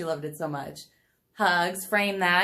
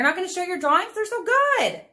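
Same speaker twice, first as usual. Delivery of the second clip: high coaxing; 10 semitones higher, 5 dB louder than usual. Real, not acted. Coaxing daughter to show drawings.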